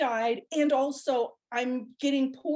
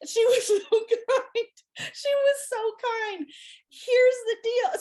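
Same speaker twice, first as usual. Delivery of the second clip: laughing; 12 semitones higher, 4 dB louder, slower than usual. Real, not acted. Laughing while telling the story.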